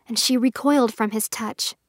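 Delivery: ordinary delivery, nothing marked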